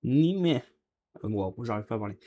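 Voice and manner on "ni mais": "ni mais" without a final N; pronounced ni mais. Shown as a demonstration. Teacher shows learners how it is pronounced, "ni mais" without the N. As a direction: say "ni mais", ending on an open vowel. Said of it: "on va le prononcer ni".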